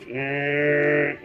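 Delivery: steady droning saw buzz; imitated electric saw. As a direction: hum like a cast saw cutting. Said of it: "and goes".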